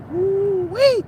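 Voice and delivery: high, delighted whoop; a high appreciative holler; woo-wee exclamation. Real, not acted. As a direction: let out an admiring woo-wee. Praising southern women.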